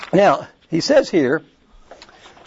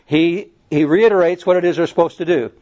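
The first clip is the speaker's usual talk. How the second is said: normal talking voice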